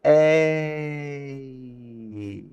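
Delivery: A sung slowly as ah then ee; drawn-out A. Shown as a demonstration. Teacher demonstrating the diphthong in A, slowly. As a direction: stretch A into its two sounds. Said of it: "say the letter A".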